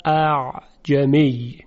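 اعجمی stretched very slowly, each sound separate; slow clear demonstration of اعجمی; drawn-out word. Shown as a demonstration. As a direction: lay out اعجمی slowly so students hear both hamzas. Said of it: "در کلمهٔ اعجمی".

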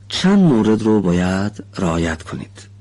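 ordinary talk

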